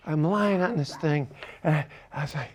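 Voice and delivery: ordinary talk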